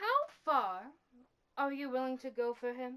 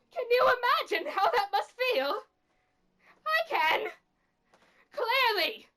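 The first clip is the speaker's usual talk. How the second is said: high, loud demand; anguished outburst; 6 semitones higher, 5 dB louder than usual. Acted. Confronting the one who cursed them, anguished outburst. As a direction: burst out, pitch jumping up. Acted outburst about losing control.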